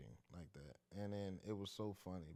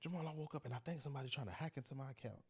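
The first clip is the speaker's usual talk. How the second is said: higher, fast mock mother voice; imitating worried mom; 8 semitones higher, faster than usual. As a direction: rush it like a fretting mom, pitched up. Son retells mom's early call.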